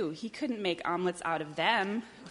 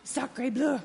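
higher mock French duck voice; French-accented cry; 6 semitones higher than usual. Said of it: "cried the duck".

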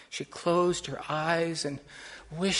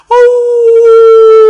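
long, high, loud howl; wolf howl; 26 dB louder than usual. A narrator's reading. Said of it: "all of a sudden heard".

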